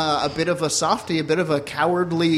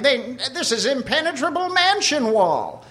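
snooty Mister Peanut; 8 semitones higher than usual. Acted. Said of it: "and he's like".

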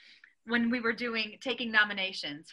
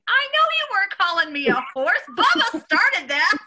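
higher, louder, laughing through it; laughing retelling; 9 semitones higher, 7 dB louder than usual. Retold or imitated. Answering the pastor's apology while laughing. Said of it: "and he's like".

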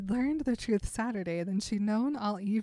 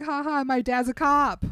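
sarcastic ha ha, higher and louder; mocking retort; 5 semitones higher, 7 dB louder than usual. Bella taunting Edward about her police dad, sarcastic and louder. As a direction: rub it in like a smug teenager.

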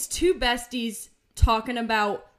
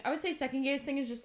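normal talking voice

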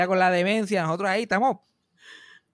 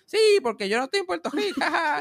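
sarcastic retort; 11 semitones higher than usual. Retold or imitated. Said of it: "Y yo".

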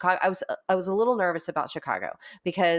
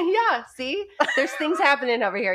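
laughing; 8 semitones higher, 4 dB louder than usual. Real, not acted. Excited after goosebumps remark.

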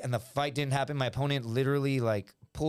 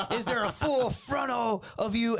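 high, drawn-out outraged demand; mock outraged screech; retold furious girlfriend; 7 semitones higher than usual. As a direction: mimic an angry girlfriend, stretching each word. Girlfriend confronts him on phone.

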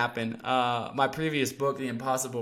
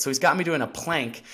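ordinary talk

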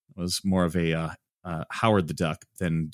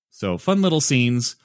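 ordinary delivery

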